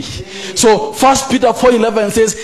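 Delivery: loud, high, impassioned sermon delivery; fiery sermon voice; fiery preaching. Acted. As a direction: preach it with full force to the congregation. Preaching at full force.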